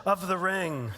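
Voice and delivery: slow, stately elf-lord pronouncement; measured, weighty proclamation; Elrond impression. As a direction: proclaim it slowly, as a solemn lord. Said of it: "Elrond says".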